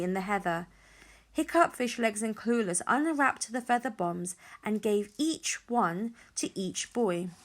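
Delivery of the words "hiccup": ordinary delivery, nothing marked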